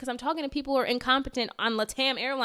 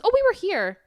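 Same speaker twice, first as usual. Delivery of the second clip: higher, louder sing-song mock of the agent; mocking the airline agent; 10 semitones higher, 6 dB louder than usual. Angry traveler mimicking the airline agent, high and mocking. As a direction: mimic her fake innocence.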